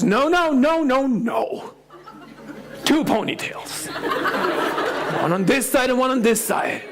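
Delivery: put-on high, insistent child voice with a laugh; little daughter's voice. Dad quoting his daughter, a high child's voice. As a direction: play the bossy little girl.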